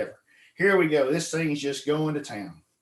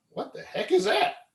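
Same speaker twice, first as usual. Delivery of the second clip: surprised. Retold or imitated. Jay sees a small catfish.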